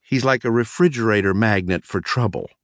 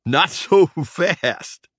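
high, slow, drawn-out giggly words; giggling; 8 semitones higher, 4 dB louder, much slower than usual. A narrator's reading. Prankster stops his cousin leaving, high giggling drawl. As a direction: break into a squeaky giggle mid-phrase. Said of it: "Trap said, giggling".

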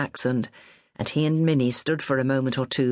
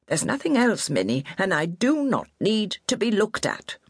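lightly voiced stubborn old woman, higher; proud old lady; 5 semitones higher than usual. A narrator's reading. Injured old lady brushing off help, lightly voiced stubborn. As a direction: insist you're fine while hurt. Bellamy downplays her injury.

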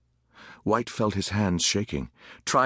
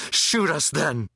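higher-pitched defiant shout; shouted dare; 5 semitones higher, slower than usual. A narrator's reading. Boy close to the soldier goads him, shouting. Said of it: "One boy shouted close to him".

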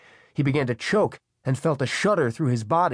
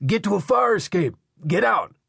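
high, urgent shouted orders; Pelham shouting; 8 semitones higher, 4 dB louder than usual. A narrator's reading. Man urging woman upstairs to escape fire, shouting. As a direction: shout to get someone out of danger. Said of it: "he shouted up into the stairwell".

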